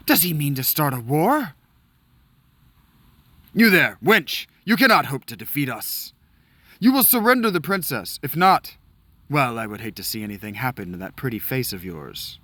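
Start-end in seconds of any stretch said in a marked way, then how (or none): 3.5-12.4 enemy knight demanding the princess; menacing enemy knight. Acted. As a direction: taunt and threaten the woman. Enemy knight threatens in cutscene.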